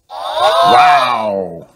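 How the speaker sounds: very high, loud cry of wonder; amazed wow. A narrator's reading. Family amazed by the toy, loud high wow. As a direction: everyone gasps in delight. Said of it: "says everyone".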